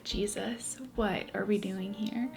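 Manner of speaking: whispered question in a hushed church. Retold or imitated. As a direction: breathe it out, barely voiced.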